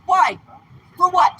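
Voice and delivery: usual speaking voice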